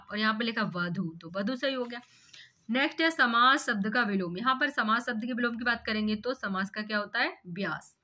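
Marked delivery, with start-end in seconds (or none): none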